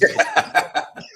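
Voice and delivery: loud burst of high laughter; big laugh. Real, not acted. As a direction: crack up out loud. Laughing at Jeff's entrance.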